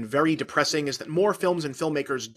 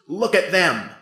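loud, higher cry for attention; mock scream; 4 semitones higher, 7 dB louder, slower than usual. Cinematography showing off its subjects, mock screaming. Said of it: "cinematography screams".

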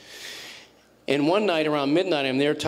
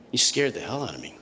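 usual speaking voice

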